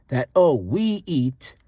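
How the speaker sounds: slowed, stressed "oh, we eat"; teacher shows the emphatic "we eat"; emphasis on we. Shown as a demonstration. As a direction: lean into "we eat", slowly. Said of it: "if you wanna emphasize".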